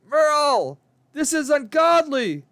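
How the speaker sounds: high, loud scream of fear; terrified yell. A narrator's reading. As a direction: cry out in panic at him. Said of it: "she yelled in terror".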